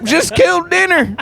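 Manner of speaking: hammed-up Southern accent, pitched high; a big, goofy Southern drawl invitation. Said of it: "they were doing Southern accents".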